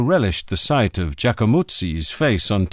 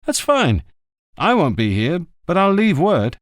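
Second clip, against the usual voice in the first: a touch higher, brisk and casual; easygoing friend on the phone, a bit quicker; lightly voiced journalist; 6 semitones higher than usual. A narrator's reading. Journalist Zitto chatting with Montalbano by phone, breezy.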